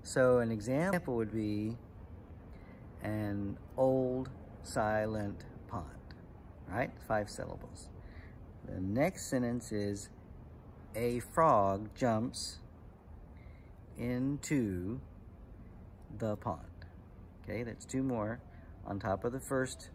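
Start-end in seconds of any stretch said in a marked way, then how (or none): 3.0-6.1 very slow, syllable by syllable; measured haiku recital. Shown as a demonstration. Teacher reciting an example haiku to kids, slow and measured. 10.9-16.9 very slow, with long pauses between phrases; measured haiku recital. Shown as a demonstration. Teacher reciting the second haiku line to kids, slow and measured.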